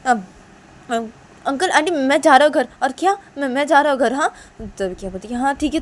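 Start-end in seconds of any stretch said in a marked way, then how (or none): 0.1-4.3 flustered friend, higher and louder; घर जाने को उतावला दोस्त. Acted. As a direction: announce you're off, voice raised. (घबराकर ऊँची आवाज़ में विदा लेता दोस्त)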